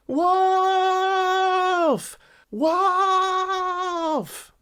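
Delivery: put-on high boy's cry of wolf. A narrator's reading. Boy tricks the villagers again, high cry. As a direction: call out at the top of his voice. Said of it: "he cried at the top of his voice".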